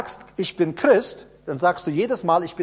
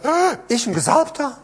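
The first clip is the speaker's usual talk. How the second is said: higher, incredulous mock voice; doubting mock question; 6 semitones higher than usual. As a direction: play the sceptic who can't believe it. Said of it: "dann sagen".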